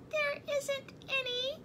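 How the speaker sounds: high squeaky mouse. A narrator's reading. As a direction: speak like a tiny mouse. Mouse character voice.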